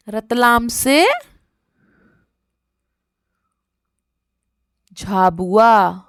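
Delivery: slow answer. Shown as a demonstration. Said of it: "slow answer modelling".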